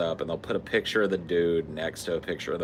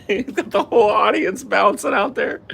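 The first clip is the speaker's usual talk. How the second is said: laughing hard; 8 dB louder, slower than usual. Real, not acted.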